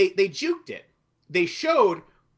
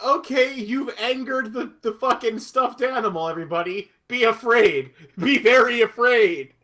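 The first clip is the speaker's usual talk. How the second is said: sarcastic mock warning; 7 semitones higher, 6 dB louder than usual. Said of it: "I sarcastically clapped".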